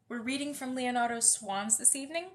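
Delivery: ordinary talk, nothing marked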